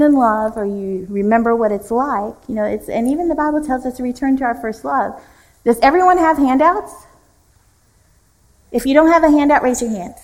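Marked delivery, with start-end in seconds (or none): 5.6-10.1 higher, louder call to the room. Real, not acted. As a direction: make sure the back row hears. Calling out to the room.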